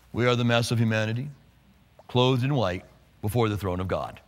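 low, soft monotone chant; mock solemn drone. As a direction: drone it like a listless robed choir. Mocks a solemn, lifeless crowd.

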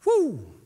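high, loud celebratory woo; preacher's rallying woo; excited whoop. Real, not acted. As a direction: let out a joyful whoop.

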